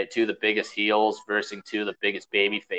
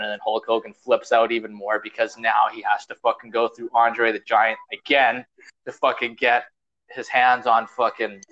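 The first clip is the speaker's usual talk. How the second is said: ordinary talk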